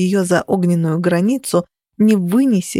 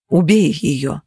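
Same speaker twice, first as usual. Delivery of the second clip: ordinary delivery, nothing marked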